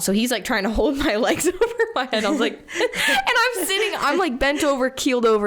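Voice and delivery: giggling through the words; laughing. Real, not acted. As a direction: laugh while you tell it. Laughing while retelling embarrassing moment.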